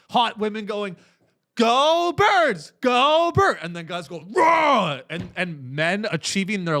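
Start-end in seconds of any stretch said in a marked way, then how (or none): 1.5-3.6 loud, high fan cheer; cheering shout. Retold or imitated. Women in the stands cheering their team, loud and high. 4.3-5.0 loud, high roar. Retold or imitated. Men celebrating the win, a loud high roar. Guys roaring at the Super Bowl.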